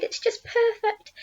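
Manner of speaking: high-pitched joyful exclamation; delighted cry. A narrator's reading. Aunt touching the peach, high delighted cry. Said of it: "she cried".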